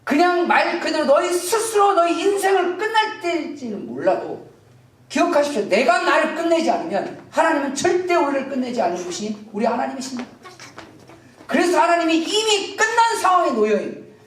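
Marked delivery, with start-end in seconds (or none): none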